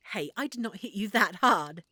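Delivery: ordinary delivery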